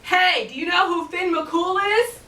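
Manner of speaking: loud, high call across the water; hollered. Acted. Giant shouting across to Finn, loud hollering call. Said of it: "he hollered across".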